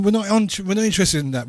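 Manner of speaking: ordinary talk, nothing marked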